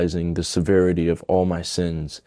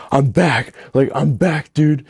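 high-pitched, amazed relief, acted out; elated retelling; 7 semitones higher, 4 dB louder than usual. Grabbing his friend after the prayer, acted-out elation. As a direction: exclaim it high, like a voice just regained. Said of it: "my voice was back. I didn't sound the same".

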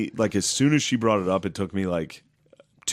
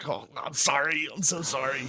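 mock fawning apology; 6 semitones higher, 4 dB quieter than usual. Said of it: "just be like".

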